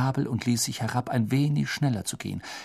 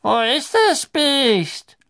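put-on high, slow, thin elderly voice; reedy old official, puzzled; old man looking around; 7 dB louder, much slower than usual. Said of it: "die dünne Stimme des Alten".

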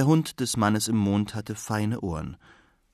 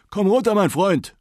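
high, loud call upward; old man calling his dog down from the moon sky; 10 semitones higher, 7 dB louder than usual. Acted. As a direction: shout up to a dog far above. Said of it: "rief er in die Luft hinauf".

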